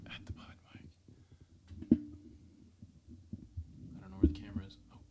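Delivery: soft unvoiced whisper. Real, not acted. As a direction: whisper it close to the mic. Whispers while showing the mic.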